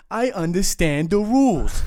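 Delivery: loud, high, drawn-out mock Boston accent. Former player mocking the gate guard back, exaggerated Boston accent. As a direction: throw a hammed-up Boston accent right back at him. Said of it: "give him my Boston accent back".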